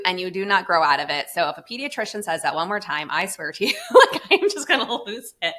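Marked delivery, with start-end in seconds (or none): 3.5-5.5 laughing exasperation. Real, not acted. Exasperated laughing threat about pediatricians.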